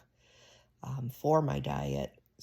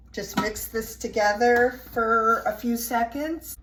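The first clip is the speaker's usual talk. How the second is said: raised voice; 7 semitones higher, 5 dB louder than usual. Real, not acted. Talking over the running blender.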